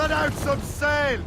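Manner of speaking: high, slow shouted command; shouted order. Acted. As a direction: bellow it to the rigging. Ordering sail on ship.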